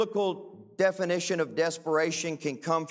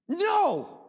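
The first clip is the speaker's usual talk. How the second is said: incredulous no; 11 semitones higher than usual. Retold or imitated. Rejecting the definition.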